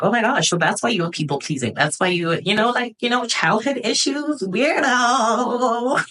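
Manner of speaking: mock self-teasing. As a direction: poke fun at herself, climbing up high. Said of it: "even now it's like".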